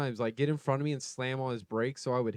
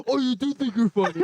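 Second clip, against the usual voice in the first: higher, louder menacing driver imitation; mock angry guy; 8 semitones higher, 4 dB louder than usual. Imitating the angry driver taunting from his truck. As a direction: sneer it like the road rager. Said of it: "The guy's like".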